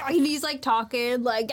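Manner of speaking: ordinary delivery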